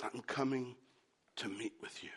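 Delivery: low, very soft, fading toward a whisper; hushed divine voice. Acted. Preacher voices God promising to come and meet Elijah, hushed. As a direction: let it drop away almost to breath. God's quoted words, softly.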